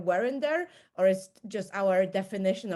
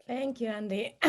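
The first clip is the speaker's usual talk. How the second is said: hoarse, voice giving out; losing voice; 4 semitones higher than usual. Real, not acted. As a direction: speak through a hoarse, failing voice. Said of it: "I'm losing my voice".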